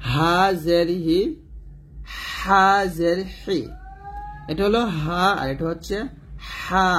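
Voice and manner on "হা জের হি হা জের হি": হা জের হি slow, হা kept thin and light. Shown as a demonstration. Teacher shows students the thin হা, slow. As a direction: drag out a thin হা. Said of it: "এই হাটা চিকন করে বলতে হবে".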